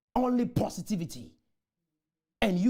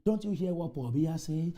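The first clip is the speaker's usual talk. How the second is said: normal talking voice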